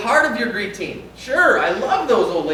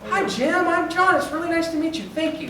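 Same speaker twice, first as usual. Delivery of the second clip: high, chipper return greeting; friendly visitor greeting; 10 semitones higher than usual. Retold or imitated. Pastor acts out a visitor greeting him back, higher and chipper. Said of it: "Guess what I would expect in return".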